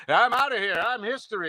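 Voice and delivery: high-pitched manic cartoon exit line; Robin Williams. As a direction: toss it off as a zany ad lib. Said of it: "doing an impression of Robin Williams".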